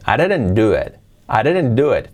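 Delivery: ordinary talk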